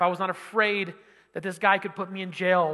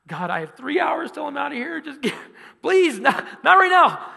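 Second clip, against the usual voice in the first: starts higher and climbs louder into a desperate plea; pleading, rising to a cry; 7 semitones higher, 5 dB louder than usual. Retold or imitated. Pleading with God in the airport, building louder and higher. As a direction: beg God to spare you, building to a shout. Begging not to write note.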